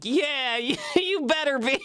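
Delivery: hammed-up put-out reply; mock indignation. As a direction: play wronged while amused. Mock indignant answer to apology.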